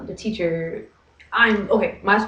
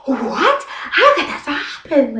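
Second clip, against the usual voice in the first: high, indignant and half-laughing; hammed-up how-dare-you, pitched up; dramatic disbelief; 8 semitones higher, 5 dB louder, slower than usual. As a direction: play up the offended shock. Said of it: "I'm so, like, overly dramatic".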